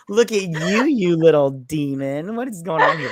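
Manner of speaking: gawk aloud with playful disbelief; hammed-up scandalized delight; mock astonishment. Acts own reaction to bared chest.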